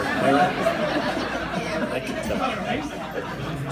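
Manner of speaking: slow, hushed, teasing aside. Real, not acted. Comic teasing a man in the crowd, softly. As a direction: lean in and tease him softly. Teasing audience member, mock hush.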